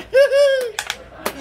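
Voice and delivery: a burst of real laughter shooting high; loud high laugh. Real, not acted. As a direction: throw back your head and howl with laughter. Big laugh at funny move.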